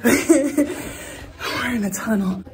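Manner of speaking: slow words through a breathy laugh. Real, not acted. Hiker laughs to viewers entering the tunnel, slowly laughing. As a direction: laugh as you stretch out the words.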